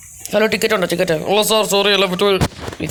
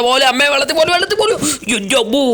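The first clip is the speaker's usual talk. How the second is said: panicked yelp; 8 semitones higher than usual. Real, not acted. Falling toward water in game.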